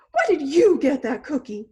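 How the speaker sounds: hammed-up pigeon protest, loud; outraged pigeon. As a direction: burst out in jealous indignation. Pigeon confronts the duckling.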